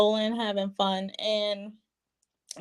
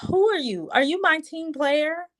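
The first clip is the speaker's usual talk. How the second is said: much higher, affronted challenge; mock indignation; 8 semitones higher than usual. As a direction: act Lindsay offended. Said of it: "she's like".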